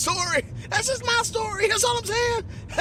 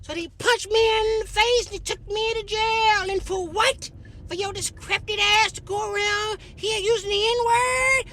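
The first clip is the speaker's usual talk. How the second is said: Rosa Parks voice, higher and slow, scolding; slow scolding old lady, higher pitched; 5 semitones higher than usual. Retold or imitated. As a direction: play a stern old woman dressing him down. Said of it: "She said".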